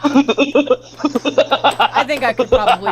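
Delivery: laughing. Real, not acted. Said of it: "laughing while talking".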